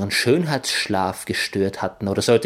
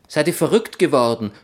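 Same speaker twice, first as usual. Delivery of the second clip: louder, fast startled yell; shouting; 5 semitones higher, 3 dB louder, faster than usual. Acted. Narrator snaps at friends who scared him, louder and fast. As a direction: bark at them after the fright. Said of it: "brüllte ich".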